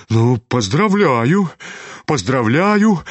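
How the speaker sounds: slow, drawn-out exclamation, rising the second time; dumbfounded, stretched-out well-wishing; amazed congratulations. A narrator's reading. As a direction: congratulate as if still stunned. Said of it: "Почти ошеломлённый от изумления проговорил генерал".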